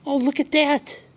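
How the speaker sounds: rising delight at a marvel; wondering excitement. A narrator's reading. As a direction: let the wonder climb with each colour. Caps come flying down.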